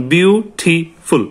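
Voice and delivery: Beautiful very slowly, split into বিউ-টি-ফুল; slow teacherly বিউ... টি... ফুল; syllable by syllable. Shown as a demonstration. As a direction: break beautiful into separate syllables with pauses. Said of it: "এটা আমরা কিন্তু একবারে বলতে পারি না".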